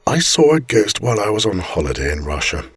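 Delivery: usual speaking voice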